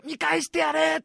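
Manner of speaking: fired-up cheer. Retold or imitated. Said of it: "とか".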